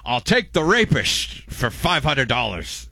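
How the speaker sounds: high, slow mock Scottish drawl; Sean Connery on Jeopardy. Host does mock Scottish Jeopardy bit, then slow. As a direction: ham up a Scottish lisp to Alex. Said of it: "Sean Connery on, on Jeopardy".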